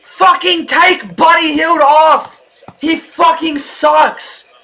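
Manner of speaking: loud, high furious yelling at the player; real fan rage; angry shout. Real, not acted. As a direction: bellow your frustration at the screen. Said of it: "I know I sound angry".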